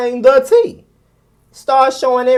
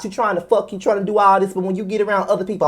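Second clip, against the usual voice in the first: ordinary delivery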